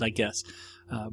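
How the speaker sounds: ordinary talk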